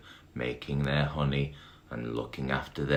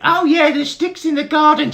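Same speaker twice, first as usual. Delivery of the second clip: very high, loud squeaky beetle voice; high squeaky Bart; 13 dB louder than usual. Acted. Dim little brother greeting Barry, high squeaky voice.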